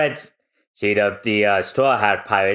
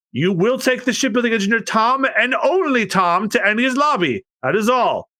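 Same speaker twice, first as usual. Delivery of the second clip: officious judge; 13 semitones higher than usual. Acted. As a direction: pronounce the sentence. Spandam and judge pronounce sentence.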